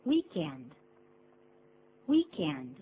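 "weekend" said as WEEK-end, stress up front; "weekend" with a strong first syllable and lighter end. Shown as a demonstration. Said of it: "重音是在第一个音节".

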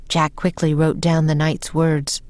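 usual speaking voice

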